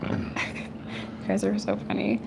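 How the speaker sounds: low, slow, deadpan sarcasm; sarcastic. Real, not acted. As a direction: drag it out, unimpressed. Sarcastic response to chat.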